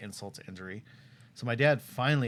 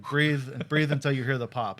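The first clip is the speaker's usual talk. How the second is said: imitating the cop; 4 semitones higher, 9 dB louder than usual. Cop instructing breath test.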